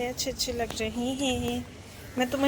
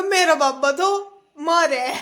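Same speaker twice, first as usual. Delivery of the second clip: quoted comment; 4 semitones higher, 8 dB louder than usual. Retold or imitated. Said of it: "किसी ने comment करा".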